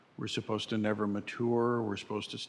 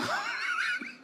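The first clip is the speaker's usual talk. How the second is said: much higher burst of laughter; high giggle. Pretend. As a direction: giggle at the joke. Laugh inside the skit.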